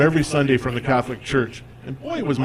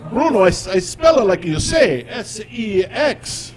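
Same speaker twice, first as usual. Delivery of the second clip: put-on higher, slow heavy Italian accent, spelling it out; Italian dad accent; 7 semitones higher, slower than usual. Dad spelling his search for Bruno, slow Italian accent. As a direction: carefully spell it like you say it. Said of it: "He says".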